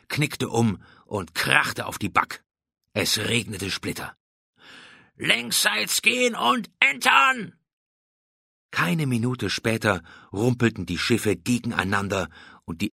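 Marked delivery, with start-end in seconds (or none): 5.2-7.5 high, drawn-out battle cry; shouted boarding order. A narrator's reading. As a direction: holler the command to the whole crew.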